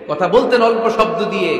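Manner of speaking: normal talking voice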